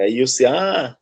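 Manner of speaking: usual speaking voice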